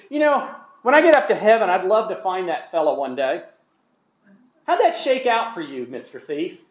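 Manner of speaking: a hint of a Scottish brogue, telling it fondly; Alistair Begg's Scottish brogue. Preacher retelling a story in a light Scottish brogue. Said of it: "he does it in this great Scottish brogue".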